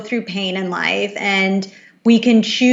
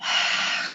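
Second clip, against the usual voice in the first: ha breath sound; 7 dB quieter than usual. Shown as a demonstration. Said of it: "Keep doing that".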